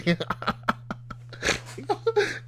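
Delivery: a long breathless high laugh; high wheezing laugh. Real, not acted. Wheezing at his friend's savory line. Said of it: "That's wild".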